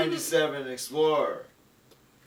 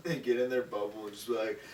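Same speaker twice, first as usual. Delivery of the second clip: usual speaking voice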